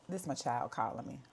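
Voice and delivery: ordinary delivery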